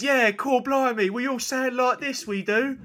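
high, loud cartoon Cockney English accent; mock English accent. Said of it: "like the one they do on The Simpsons".

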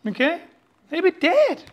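usual speaking voice

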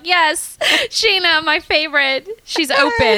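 high, giddy and laughing; excited spirit voice. Retold or imitated. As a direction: gush like a delighted spirit. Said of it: "She's gonna be like".

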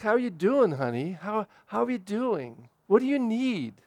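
put-on soft, caring questions to a grown child; quiet concerned parent checking in; gentle asking. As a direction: ask tenderly, no pressure. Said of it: "rather than harangue ... just ask".

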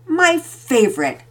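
husband thrilled by coffee; pleased. A narrator's reading. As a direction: savour the words. Daddy pleased with gift.

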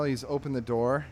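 ordinary talk, nothing marked

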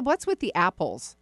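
ordinary delivery

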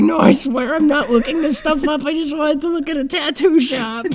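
high-pitched panicked protest, half laughing; mock freak-out. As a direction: plead innocence in a high, panicky voice. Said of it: "I was, like, freaking out".

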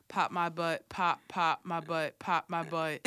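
low, deadpan recital; super monotone. Retold or imitated. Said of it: "in a super monotone voice".

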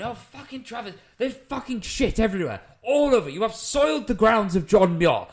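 high-pitched indignant rant, rising in volume; kicking off. Retold or imitated. Landowner raging at travellers on his grounds, imitated angry rant. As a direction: imitate an outraged landowner ranting. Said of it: "he's kicking off at these travellers".

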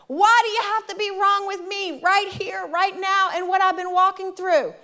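high, pleading mock anger; retold mad complaint. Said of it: "I was so mad".

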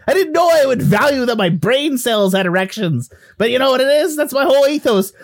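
high, hammed-up Irish-Canadian rant; outraged ghost Willie. Ghost stung by a player's jibe, mock-outraged. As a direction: get comically indignant in the mixed accent.

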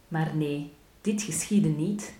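ordinary delivery, nothing marked

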